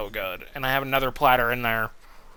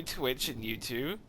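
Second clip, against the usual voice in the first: ordinary delivery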